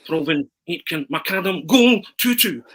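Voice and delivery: commentator build, high and loud on goal; Montford commentary. Retold or imitated. Reciting Arthur's commentary lines on request, building to a shouted goal. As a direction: rattle off names then yell the goal. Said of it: "You do it".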